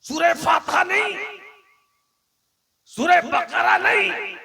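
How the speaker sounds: a preacher rising to a shout; fervent preaching. Real, not acted. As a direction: build each phrase up with force. Preacher builds a fervent list.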